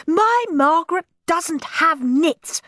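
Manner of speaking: high, indignant shriek; shrieking mother. A narrator's reading. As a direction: shriek it outraged. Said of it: "shrieked Moody Margaret's mother".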